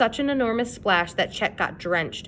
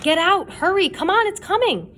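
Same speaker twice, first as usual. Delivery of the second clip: high, loud desperate scream; 11 semitones higher, 4 dB louder than usual. A narrator's reading. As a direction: scream at him to move. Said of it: "They all screamed at Sid".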